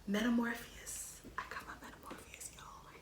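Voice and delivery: soft, unvoiced, hushed; awed whisper. Real, not acted. Fan whispering the album name in awe. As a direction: whisper it reverently.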